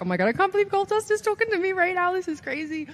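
high-pitched fangirl gush; starstruck. Retold or imitated. Rookie thinking about Goldust talking to her, starstruck.

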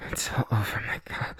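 breathy unvoiced whisper. Lover whispering in surprise to partner, whispered. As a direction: whisper it close, as if to yourself. Intimate roleplay, hushed aside.

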